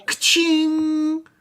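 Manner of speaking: very high gleeful cheer; excited cheer. Acted. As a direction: squeal it like a jackpot. GM cheers wolf's success.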